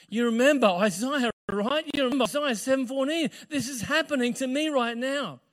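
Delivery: high, urgent, excited pleading; excited insistence. Acted. Woman convincing her fiancé of prophecy, excited insistence. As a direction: press the point eagerly, pitched high. Acting Mary urging Joseph to believe.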